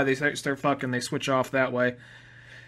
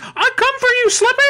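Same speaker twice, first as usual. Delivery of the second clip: very high, louder mock battle cry; barbarian toad; 8 dB louder than usual. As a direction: bellow it in a squeaky cartoon villain voice.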